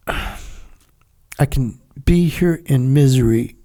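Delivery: drawn out, weary phrasing; slow and heavy. Real, not acted. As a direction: let each word sink, very slowly. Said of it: "slow reflective delivery".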